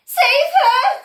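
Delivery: very high, loud shriek; desperate cry. A narrator's reading. Mother crying out to save her daughter, loud shriek. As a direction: cry out in despair. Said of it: "cried Missus Beauregard".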